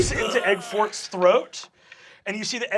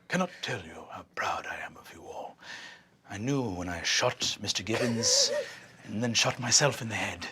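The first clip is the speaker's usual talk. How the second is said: much lower, softer, whispered; proud farewell breathed out; soft unvoiced farewell; tender whisper; 12 semitones lower, 10 dB quieter than usual.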